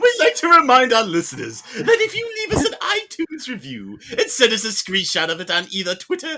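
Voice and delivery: high, loud, jangly cartoon cat man; fat man cat voice. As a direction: ham up a jiggly fat cat announcer. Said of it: "my jimble jangle fat man cat voice".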